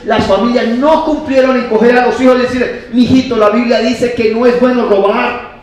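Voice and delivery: loud, high, impassioned sermon; full-force preaching. Acted. Preacher blames the church for society's thieves, full-force preaching.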